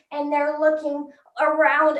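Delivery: ordinary talk, nothing marked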